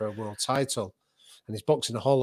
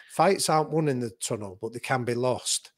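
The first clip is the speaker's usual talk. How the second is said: usual speaking voice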